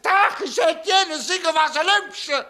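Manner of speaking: high, loud and slow mock posh order; pompous customer order. Pretend customer to shop clerk, hammed-up order. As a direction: announce the order like a grand proclamation.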